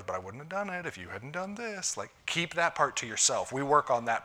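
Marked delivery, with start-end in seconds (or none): 0.0-2.0 higher, softer grumbling inner voice; petty inner voice. Retold or imitated. Pastor voicing a husband's defensive inner thought, mildly sulky. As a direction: mutter it like a sulky voice in your head. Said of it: "a little voice in your head that goes".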